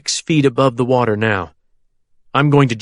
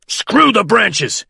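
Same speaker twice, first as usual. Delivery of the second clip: slow, high growl; 9 semitones higher, much slower than usual. A narrator's reading. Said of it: "Carl growls".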